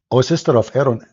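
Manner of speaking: normal talking voice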